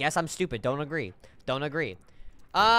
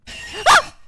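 startled shriek; 8 dB louder than usual. Real, not acted. Jump scare.

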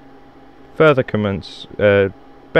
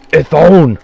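sudden loud yell; shout; 6 semitones higher, 11 dB louder than usual. Real, not acted. Gamer yelling at a monster that appears. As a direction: bark it out at the enemy.

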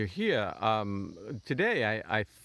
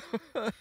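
high helpless giggle. Real, not acted. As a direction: let a giggle escape. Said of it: "I can't stop laughing".

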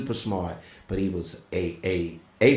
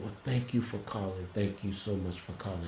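weak, faint voice; 8 dB quieter than usual. Retold or imitated. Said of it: "He could barely speak".

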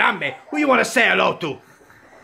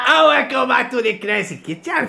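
high, bright cooking-show welcome; show host intro; 6 semitones higher than usual. Pretend. Dad opening his pretend cooking show as host. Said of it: "hosting his kitchen show".

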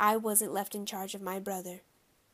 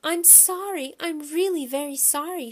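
high, distressed apology; upset girl pleading, pitched up; crying out; 7 semitones higher, 5 dB louder than usual. A narrator's reading. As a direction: wail your apology to an adult. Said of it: "Shyla cried".

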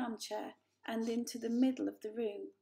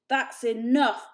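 much louder, slow furious cutoff; blazing anger; 3 semitones higher, 13 dB louder, slower than usual. A narrator's reading. Father shutting down the elder Poor, furious and loud. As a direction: slam the door on the argument. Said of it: "blazed Father".

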